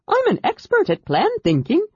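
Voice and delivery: high, quick babbling voice; a nauseous little creature rambling on; chatty Kender. A narrator's reading.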